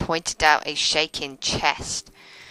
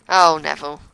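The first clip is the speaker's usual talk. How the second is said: ordinary delivery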